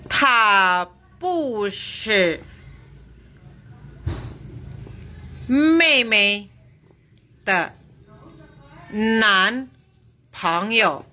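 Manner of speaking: Ta bu shi mei mei de nan pengyou dictated syllable by syllable; very slow dictation. Shown as a demonstration. Teacher dictating a sentence so students can follow, very slow. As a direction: lay each word out with long gaps. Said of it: "teacher models Mandarin sentence".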